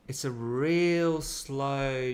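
very slow, stretched words; drawn out slowly. Shown as a demonstration. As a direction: stretch it out like the turn. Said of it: "demonstrates slow drawn-out turn".

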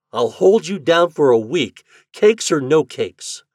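put-on loud, high boyish threat; threatening. A narrator's reading. Chuck promising to pin Toad again, threatening. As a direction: lay down the warning, loud and emphatic. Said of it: "he threatened".

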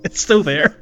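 usual speaking voice

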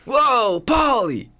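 very high loud cartoon cry; cartoon shout. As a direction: yelp it like a sitcom character. Sudden impression shout.

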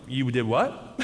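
low, slightly held-back "you did what?"; stunned disbelief. Retold or imitated. Hotel clerk hearing of guests' early run, low stunned disbelief. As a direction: imitate a clerk taken aback, pitched low.

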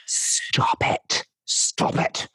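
slow, drawn-out whispered plea; exasperated whisper. Acted. To a noisy chewer, slow exasperated whisper. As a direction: whisper it slowly, unable to bear it. Acted reaction to loud chewing.

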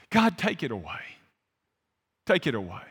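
acted prayer sinking low and soft; quiet, resigned begging; subdued plea. Acted prayer fading into weary plea.

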